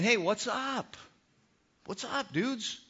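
high, laid-back surfer greeting; breezy cool-guy hey; casual dude talk. Retold or imitated. Jesus greeting the fishermen like a laid-back dude. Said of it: "he didn't say it like that".

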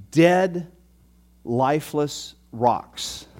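slow, drawn-out emphasis; slow, heavy dramatic delivery. Shown as a demonstration. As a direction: stretch out lifeless for weight. Dramatic image repeated for effect.

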